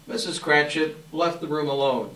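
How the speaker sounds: usual speaking voice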